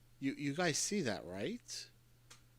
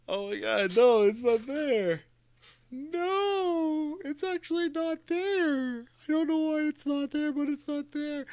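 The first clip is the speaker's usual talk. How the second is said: high, slow, whining disbelief; disbelieving whine; 14 semitones higher, 6 dB louder, slower than usual. Real, not acted. Streamer telling chat the file has vanished, disbelieving whine. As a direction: stretch every word out in high-pitched dismay.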